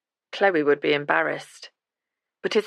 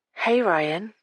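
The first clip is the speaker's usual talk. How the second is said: breathy voice; 3 semitones higher than usual. A narrator's reading. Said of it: "she said in her breathy voice".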